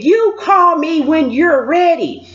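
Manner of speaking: slow, high mimicked order; stern officer. Officer sending her back to the unit, stern. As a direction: spell it out, imitating a stern officer.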